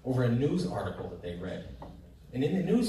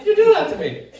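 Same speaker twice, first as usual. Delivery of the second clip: much higher, much louder indignant yell; outraged shout; 14 dB louder than usual. Acted. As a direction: explode at the patient in mock outrage. Acting out an outraged doctor.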